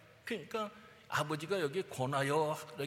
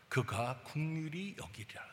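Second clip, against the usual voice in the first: hushed scripture; 4 dB quieter than usual. Acted. Quoting Isaiah's promise.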